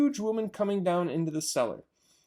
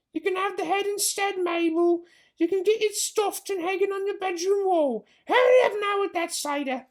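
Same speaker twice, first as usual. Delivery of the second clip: high put-on voice, louder on the order to hurry; bossy farmer's wife; 4 dB louder than usual. Missus Bean orders Mabel to hurry, high bossy voice. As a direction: order the maid about.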